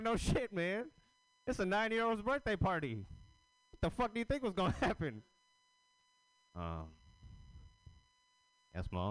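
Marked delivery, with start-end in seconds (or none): 0.0-5.2 high, exasperated mock yell at the uncle; incredulous yell. Retelling yelling at his crackhead uncle at the party, exasperated. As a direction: blurt it out in disbelief.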